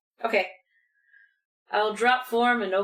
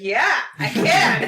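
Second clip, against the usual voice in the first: loud, slow, gleeful yell; excited shout; 4 semitones higher, 10 dB louder, slower than usual. Pretend. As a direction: shout it like an eager new rat. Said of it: "just be like".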